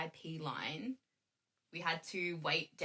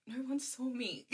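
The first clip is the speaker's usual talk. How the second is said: lightly voiced shy idol, higher; a hint of sulky Junie, pitched up; mock Junie voice; 6 semitones higher than usual. Imitating Junie standing unseen.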